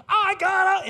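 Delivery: high loud joyful shout, cut off; ecstatic scream. Retold or imitated. Golfer running up to the pastor, ecstatic shout. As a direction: scream it mid-run.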